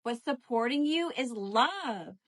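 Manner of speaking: ordinary delivery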